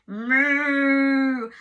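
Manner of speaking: loud, long cow moo; mournful farmyard moo. Acted. Cow lowing in story.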